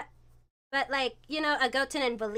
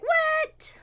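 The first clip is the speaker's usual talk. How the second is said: put-on high-pitched incredulous kid cry; shocked protest; 4 dB louder than usual. Grounded kids answering mom, high shocked protest. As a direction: squeal it in disbelief.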